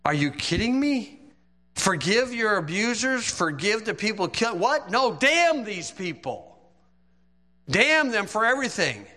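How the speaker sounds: a thief on the cross, scornful and furious; outraged thief. Acted. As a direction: spit it out like a dying man cursing. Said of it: "And he's like".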